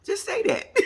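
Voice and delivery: high, sassy comeback; sassy retort. Retold or imitated. Host sassing the brother's real motive.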